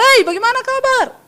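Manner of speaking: high-pitched hearty call; rising, brash greeting. Shown as a demonstration. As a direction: greet brightly with the ending kept up high. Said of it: "kalau ngomong nada belakangnya naik".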